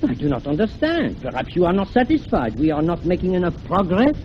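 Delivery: puzzled phony French professor; careful speech in a put-on French accent. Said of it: "talking like this with a French accent".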